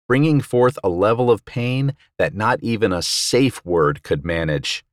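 usual speaking voice

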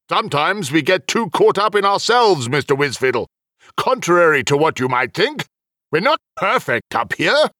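humbled otherworldly official; official admitting fault. Acted. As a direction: confess imperfection with some dignity. Voices Heliok admitting fault.

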